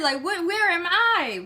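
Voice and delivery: high, stammering confusion; confused panic. Retold or imitated. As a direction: stumble over where am I. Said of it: "And I'm just like".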